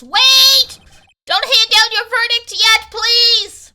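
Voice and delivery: high, loud courtroom plea; urgent shout. Acted. A lawyer begs the court to hold its verdict, shouting high. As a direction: shout it out pleadingly, pitched right up.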